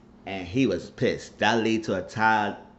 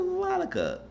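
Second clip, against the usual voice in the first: high disbelief. Real, not acted. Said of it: "I said".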